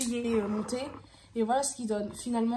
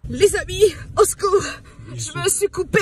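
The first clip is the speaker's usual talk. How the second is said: mock panic; 7 semitones higher, 11 dB louder than usual. Comic panic over her own haircut.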